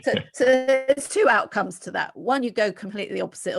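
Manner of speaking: ordinary delivery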